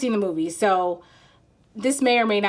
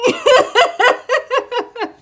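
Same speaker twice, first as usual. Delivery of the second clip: much higher, loud laughing; helpless high giggle; flustered laugh; 10 dB louder than usual. Real, not acted. Sweating reader laughing at herself. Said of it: "I'm sweating".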